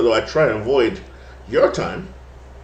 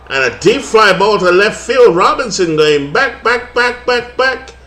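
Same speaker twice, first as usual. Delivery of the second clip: louder, climbing much higher on each back; 8 semitones higher, 5 dB louder, slower than usual. Acted. Broadcaster calling the fly ball to the wall, excited.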